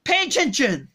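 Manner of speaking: ordinary talk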